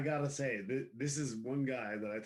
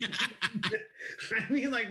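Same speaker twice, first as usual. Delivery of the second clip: real laughter through the words, higher and louder; laughing; 8 semitones higher, 4 dB louder, much slower than usual. Real, not acted. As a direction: laugh while you explain yourself. Laughing at the innuendo.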